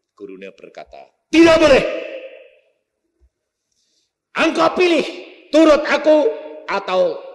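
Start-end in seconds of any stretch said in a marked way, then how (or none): 1.3-7.3 loud, high angry ultimatum; shouting down his disobedient pupil; furious teacher. Acted. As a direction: bellow the choice at him like a threatening master. Teacher threatens the convert.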